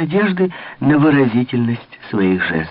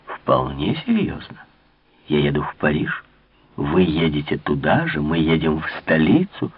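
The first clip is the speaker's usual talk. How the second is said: lightly voiced Malicorne, calm; 6 semitones lower than usual. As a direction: state it plainly, a lower, calm suitor. Narrator voices Malicorne low.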